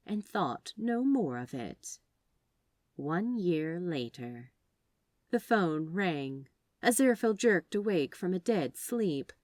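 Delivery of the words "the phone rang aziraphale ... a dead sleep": ordinary delivery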